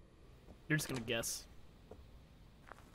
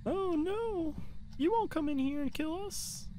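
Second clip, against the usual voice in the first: high frantic taunt; 12 semitones higher, slower than usual. Real, not acted. Panicked mock-taunt during hunt.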